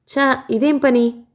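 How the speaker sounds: high, slow irritated rebuke; annoyed. A narrator's reading. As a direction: scold him with mild annoyance. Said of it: "విసుగ్గా చూశాను".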